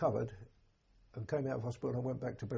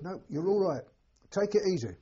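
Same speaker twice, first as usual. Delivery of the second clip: higher and louder, calling out calm words; loud reassurance; 6 semitones higher, 6 dB louder than usual. Retold or imitated. Stretcher bearer urging a wounded lad to stay calm, louder and higher. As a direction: reassure him firmly over the shelling.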